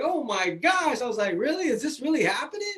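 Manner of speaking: high, disbelieving gasp of a question; stunned disbelief. Retold or imitated. Hearing from the bank his business is gone, stunned disbelief. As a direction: replay the moment the bad news hit. Retold shock at losing business.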